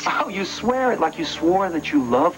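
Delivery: scornful accusation. Acted. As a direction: throw the oath back at them. Throws her old oaths back.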